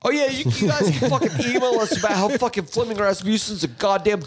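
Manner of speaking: hint of a cocky [name] drummer; [name] impression. Podcaster doing [name] to listeners, lightly voiced. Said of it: "This is [name]".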